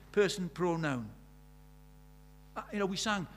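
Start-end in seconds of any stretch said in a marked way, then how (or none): none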